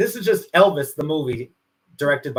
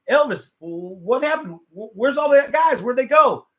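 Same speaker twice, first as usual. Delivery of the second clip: higher-pitched acted puzzlement, building louder; bewildered Charlie Hodge; 8 semitones higher, 3 dB louder than usual. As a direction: ask in mock alarm. Acted Charlie asks where everyone went.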